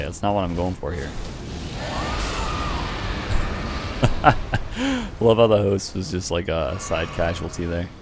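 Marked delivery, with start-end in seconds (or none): 1.2-5.1 high breathy giggling; giggle fit. Real, not acted. Laughing at hitting the host by accident, giggle fit. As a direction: dissolve into giggles.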